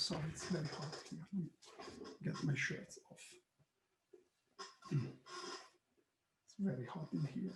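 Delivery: very soft, off-hand muttering; apologetic aside. Real, not acted. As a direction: drop to a murmur, half to yourself. Aside while coping with the heat.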